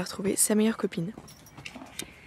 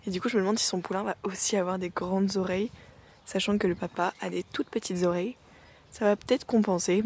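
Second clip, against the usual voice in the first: ordinary talk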